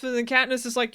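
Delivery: ordinary talk, nothing marked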